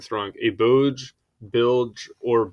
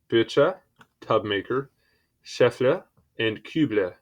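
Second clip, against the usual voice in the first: normal talking voice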